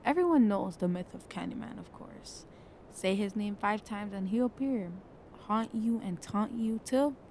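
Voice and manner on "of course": breathy whispered tag-on; tossed-off whisper; whispered aside. Acted.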